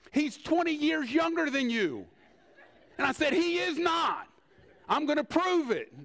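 ordinary delivery

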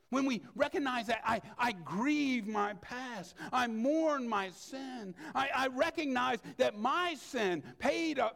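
earnest sermon fervor; fervent preaching. Acted. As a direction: press each line of the confession home. Preaching confession.

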